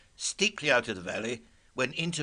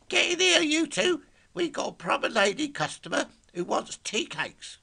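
London bellow. Acted. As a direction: bellow it out in a broad London accent. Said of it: "a stentorian bellow in a very London accent".

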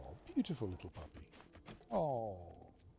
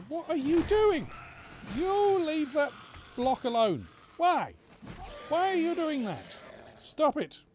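exasperated yelling; 13 semitones higher, 6 dB louder than usual. Real, not acted. Yelling at zombie minions.

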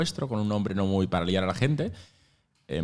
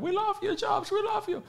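high, much higher shrieked chant; fans screaming. Retold or imitated. People in the background screaming love at Jobs, high shrieks. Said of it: "había un par de fondo que gritaban".

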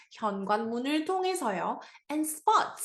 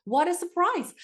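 excited surprise; 7 semitones higher, much slower than usual. Shown as a demonstration. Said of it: "한번 해볼까요?".

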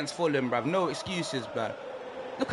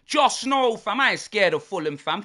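ordinary delivery

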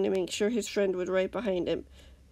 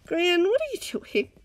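wary; 10 semitones higher, 3 dB louder than usual. A narrator's reading. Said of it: "Bull asked warily".

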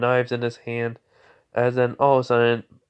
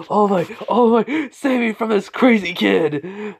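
high, loud, drawn-out cry for help; panicked plea; 11 semitones higher, 5 dB louder, slower than usual. Acted. Sludge villain begging All Might for rescue, panicked wail. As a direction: wail desperately for rescue, pitched way up. Villain begs hero to save him.

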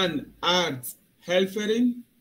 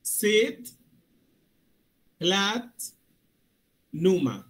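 each part said apart, slow and loud; Sit, Platz, Nummer said slowly as separate words; slow, syllable by syllable; 2 semitones higher, 3 dB louder, much slower than usual. Shown as a demonstration. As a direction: split the word into slow parts. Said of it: "bien despacito".

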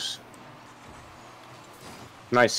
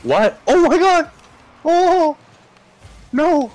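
much higher, loud disbelief; shocked cry; 5 dB louder, slower than usual. Real, not acted. Shocked by opponent save.

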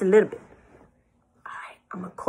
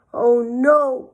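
mild, higher and louder dismay; hammed-up "oh no" of dismay; cried in despair; 6 semitones higher, 7 dB louder than usual. A narrator's reading. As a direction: cry out in storybook despair. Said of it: "Pig cried in despair".

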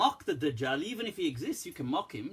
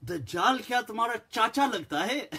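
mocking retort; 6 semitones higher than usual. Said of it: "So I was like".

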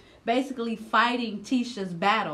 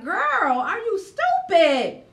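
exasperated outburst; 11 semitones higher, 6 dB louder, slower than usual. Retold or imitated. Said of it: "but it's like".

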